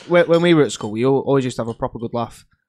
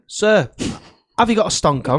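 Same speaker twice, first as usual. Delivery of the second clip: loud cheeky question; 6 semitones higher, 4 dB louder than usual. Retold or imitated.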